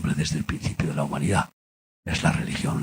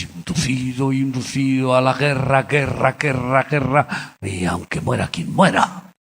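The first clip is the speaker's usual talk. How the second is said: climbing louder on each guerra; rising preaching fervor; 6 semitones higher, 6 dB louder than usual. Real, not acted. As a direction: hammer the word guerra harder each time. Preacher denouncing war, building.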